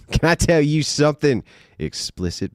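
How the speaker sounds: high, loud mock come-on; jokey, over-eager seduction attempt; hammed-up pickup line. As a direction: push the pickup line way up in pitch. Repeats pickup line, pitched up.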